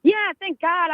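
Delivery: high relieved sigh of words. Real, not acted. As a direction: relief that the call works. Relief after dropped call.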